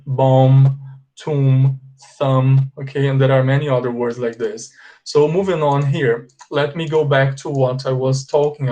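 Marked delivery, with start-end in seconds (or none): 0.1-2.7 bomb, tomb, thumb each louder with no B; bomb, tomb, thumb, final B unspoken; silent B pattern. Shown as a demonstration. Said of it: "um padrão, olha".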